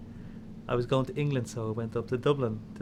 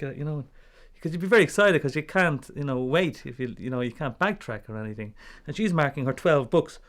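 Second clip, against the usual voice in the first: ordinary delivery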